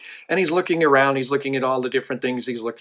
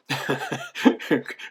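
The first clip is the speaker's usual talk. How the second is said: chuckle. Real, not acted. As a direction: chuckle at your own joke. Amused by own joke mid-telling.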